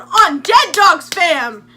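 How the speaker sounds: high, loud, slow crew call; hype shout. Retold or imitated. Narrator shouts to her groupies in the ward, hype call. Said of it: "and I'm going".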